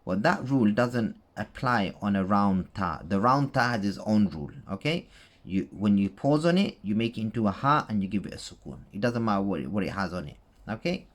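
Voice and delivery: ordinary delivery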